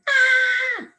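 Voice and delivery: high, loud mock scream on the drop. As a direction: scream Ah! like you're plunging down the coaster. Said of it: "maybe I scream".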